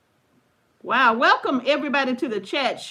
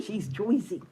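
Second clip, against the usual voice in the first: put-on Jersey accent; 5 semitones lower, 7 dB quieter than usual. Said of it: "they have a very distinct accent".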